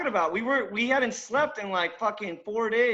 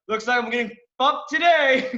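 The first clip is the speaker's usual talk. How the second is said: high, raised mock proclamation; retold loud grumble to the room; loud announcement; 4 semitones higher, 4 dB louder than usual. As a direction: imitate a coworker announcing his doom. Imitating coworker announcing his bad day.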